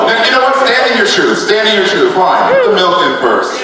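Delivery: high-pitched, exasperated mock concession; mock indignation. Conceding to the milk-first fans, mock indignation. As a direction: give in with playful indignation.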